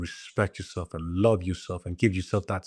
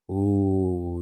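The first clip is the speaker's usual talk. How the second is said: ordinary talk, nothing marked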